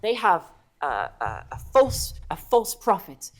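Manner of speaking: stammering, excitable little follower; eager kobold. Acted. Kobold telling the Mighty One about the false dragon, eager. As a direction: spill the news haltingly, rising high on the denials. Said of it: "very eager to share this knowledge with you".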